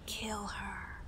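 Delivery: a breathy whispered urge; soft like. Acted. The inner voice urging her to kill, whispered. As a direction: whisper it like a voice inside. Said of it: "At first, soft like".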